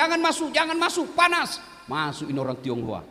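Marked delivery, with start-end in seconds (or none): 0.0-1.6 mock yell. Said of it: "Dia teriak".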